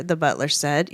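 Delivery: ordinary delivery, nothing marked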